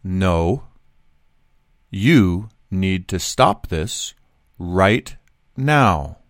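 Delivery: low, measured, word-by-word demand; firm slow command. Retold or imitated. Passenger with hand up to seatmate, slow firm command. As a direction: space out every word, pitched low. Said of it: "I hold up my hand and I say".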